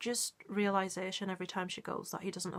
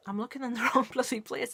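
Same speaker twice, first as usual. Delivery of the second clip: a little louder, laughing through the words; laughing at herself; 4 semitones higher, 5 dB louder than usual. Real, not acted. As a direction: laugh off the slip. Laughs at own mistake.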